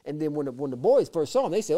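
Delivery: ordinary talk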